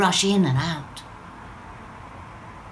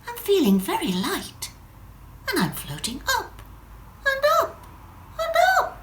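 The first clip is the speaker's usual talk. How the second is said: higher and higher, climbing in pitch with each up; Wendy rising; 13 semitones higher than usual. Acted. Drop describing her rise to the listener, climbing higher. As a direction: float the words upward in wonder.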